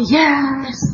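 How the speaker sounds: high, long-held yes for comic effect; eager yes. Retold or imitated. Speaker quoting her program hero agreeing to make coffee, eager. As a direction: sing out agreement, high and drawn out.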